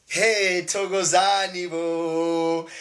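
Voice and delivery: loud, drawn-out welcome; a joyful shout-out to a friend arriving; calling out a greeting. Real, not acted. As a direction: hail him warmly across the room. Greeting a guest joining the live.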